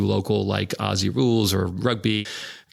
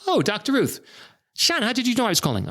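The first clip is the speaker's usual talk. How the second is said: much higher, amazed mock voice; hammed-up astonishment, pitched way up; Doctor Ruth's surprise imitated up high; 13 semitones higher, faster than usual. Doctor Ruth surprised on phone.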